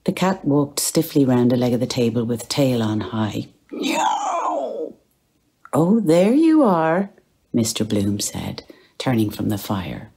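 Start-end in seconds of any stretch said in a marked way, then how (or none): 3.7-5.0 high drawn-out cat cry; cat's meow. Acted. The cat calling Bloom, a high mewing cry. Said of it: "The cat mewed in answer". 5.7-7.1 higher, louder and slow, pleased to see her; fond greeting. Acted. Bloom greeting his cat at the fire, fond and slow.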